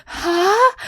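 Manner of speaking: high, loud baffled huh; shocked confusion; loud confused huh. Retold or imitated. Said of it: "I was like".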